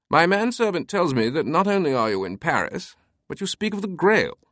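put-on thick British accent, high and light; crisp British accent. A narrator's reading. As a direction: play the crisp British eccentric. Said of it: "recognizing the thick British accent".

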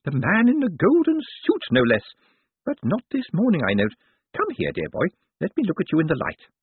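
put-on high old lady voice, appraising; Missus Lavish. A narrator's reading. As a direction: size up a newcomer as a feisty elderly lady.

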